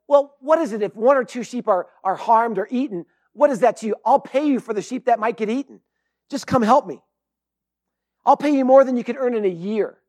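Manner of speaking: retold high-pressure haggling; pressuring hunter. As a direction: push the offer hard, voice up. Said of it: "he's really putting the pressure on".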